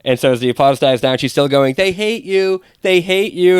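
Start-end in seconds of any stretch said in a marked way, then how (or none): 1.7-3.6 retold heckler's yell. Comic retelling a drunk heckler yelling over applause, mildly mocked. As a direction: imitate a jeering heckler without shouting. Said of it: "she's yelling over the applause".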